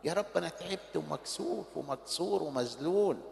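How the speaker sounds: soft, low, broken-hearted plea; weary prayer. Acted. Preacher voicing a tired sinner's prayer to God, soft and weary. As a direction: pray it quietly like an exhausted son.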